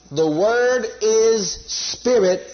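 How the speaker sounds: very slow "The Word is spirit"; emphatic teaching. Shown as a demonstration. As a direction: stretch out each word of "The Word is spirit". Preacher drives the lesson home.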